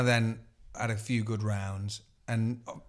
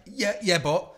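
mocking whiny retort; 5 semitones higher, 5 dB louder than usual. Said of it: "He's like".

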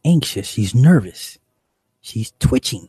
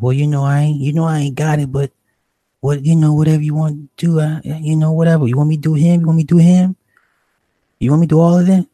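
loud, higher-pitched mimicry of an agitated woman; animated; 7 semitones higher, 7 dB louder, faster than usual. Woman bargaining with the dealer for drugs, animated. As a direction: imitate her pleading, high and loud. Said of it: "she's very animated".

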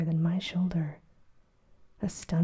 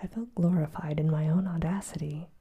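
ordinary delivery, nothing marked

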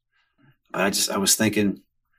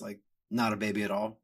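ordinary delivery

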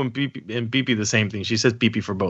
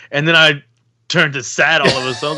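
normal talking voice

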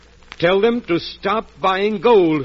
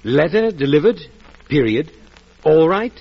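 "Letter delivered," then pause, "period," then pause, "all right" rising; read with full stops; slower than usual. Shown as a demonstration. Trent checks the telegram with Norman, pausing between words. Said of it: "didn't it say".

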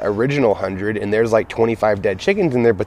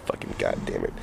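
very low, soft grumble; mock exasperated low murmur; disgruntled cops; 16 dB quieter than usual. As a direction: grumble it under your breath like a resigned cop. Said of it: "they'd just shake their head like".

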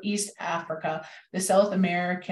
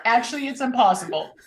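higher, louder, quick words breaking into laughter; laughing; 6 semitones higher, 4 dB louder, faster than usual. Real, not acted. Joking about the hummingbird's math, laughing. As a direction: say it through a real laugh.

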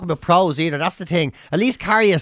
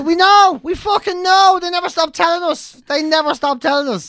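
exasperated; 11 semitones higher than usual. Real, not acted. Exasperated about Dublin reputation.

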